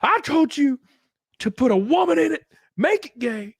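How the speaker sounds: the executive slams the plate and screeches at the waiter; mock angry shriek. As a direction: screech it like a cartoon tantrum. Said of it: "slams the plate".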